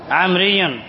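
ordinary delivery

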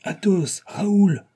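high-pitched call of two names. A narrator's reading. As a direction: hail friends from behind. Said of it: "criait cette voix".

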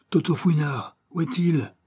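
high, loud call for the missing man; anxious shout. A narrator's reading. Monsieur Charlet arrives, shouting for Toto. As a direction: shout out searching for him. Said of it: "cria monsieur Charlet".

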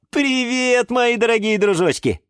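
high, cheery hello; smiling greeting. A narrator's reading. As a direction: greet dear little friends with a smile. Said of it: "сказала улыбающаяся".

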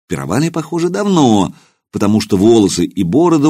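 ordinary delivery

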